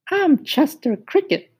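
very high, sing-song cricket asking who's there; high musical cricket. Acted. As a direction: squeak it up high like a tiny cricket. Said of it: "He had a high musical voice".

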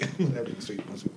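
usual speaking voice

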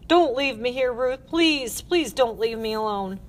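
high, urgent begging; desperate pleading. Acted. Girl begging Ruth not to leave, desperate.

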